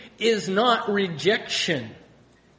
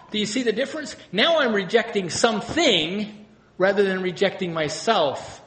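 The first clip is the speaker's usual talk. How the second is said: ordinary talk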